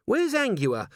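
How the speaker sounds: high, worried voice; receding panic. A narrator's reading. Dwarf looking for Angua, lingering panic. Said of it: "her panic receding a little".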